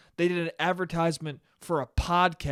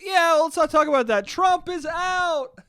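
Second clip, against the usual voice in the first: dismissive crowd; 11 semitones higher, 4 dB louder than usual. Retold or imitated. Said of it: "they're gonna be like".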